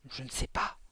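breathy whispered reply; hushed whisper. A narrator's reading. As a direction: whisper back, low and secret. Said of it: "répondit Mathilda sur le même ton".

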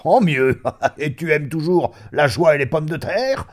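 high, loud, delighted; hearty, chummy exuberance; boisterous old friend. Acted. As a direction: laugh and carry on teasing. Friend delighted, teasing old pal.

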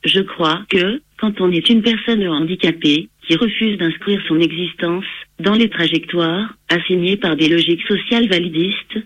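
synthetic computer voice reading text; text to speech voice. Real, not acted. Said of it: "J'utilise un logiciel de synthèse vocale".

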